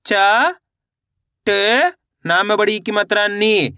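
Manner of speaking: each letter held long, then recited evenly; deliberate syllable-by-syllable demonstration; sounding out letters. Shown as a demonstration. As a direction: spell it out in a sing-song classroom cadence. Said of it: "अब उच्चारण किस तरीके से करेंगे".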